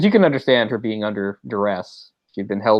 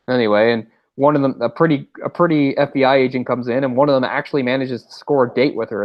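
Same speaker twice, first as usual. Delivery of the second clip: ordinary delivery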